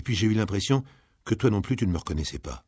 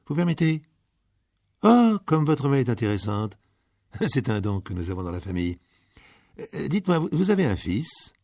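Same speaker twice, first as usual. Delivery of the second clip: higher, eager fortune teller, lightly voiced; 6 semitones higher, 3 dB louder than usual. Fortune teller reading a grieving mother's palm, eager and higher. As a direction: sound intrigued as you read the palm.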